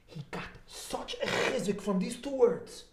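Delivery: usual speaking voice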